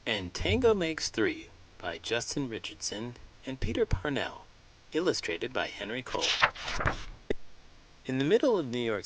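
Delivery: put-on warm, lively read-aloud for young kids; storybook narration. A narrator's reading. Host reading a picture book to children, lively storybook narration. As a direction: tell the penguin story to a room of children.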